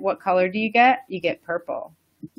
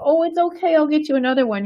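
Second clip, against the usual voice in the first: higher, soothing mother's voice; comforting mom; 7 semitones higher, faster than usual. Retold or imitated. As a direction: soothe a crying child. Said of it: "mom go and hug the child and go".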